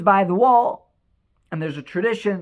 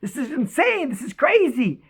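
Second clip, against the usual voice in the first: higher-pitched, acted astonishment; mildly overwhelmed disbelief; 7 semitones higher than usual. David has found his dad's note, mildly acted disbelief. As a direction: play him lightly shaken, pitch lifted. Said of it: "trembling, he's shaking".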